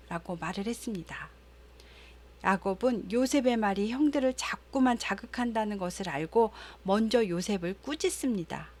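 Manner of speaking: usual speaking voice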